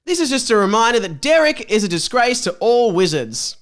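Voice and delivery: ordinary talk, nothing marked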